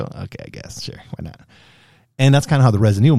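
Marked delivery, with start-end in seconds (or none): 0.0-1.4 breathy whisper, giving in; whispered shrug. Retold or imitated. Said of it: "like, go".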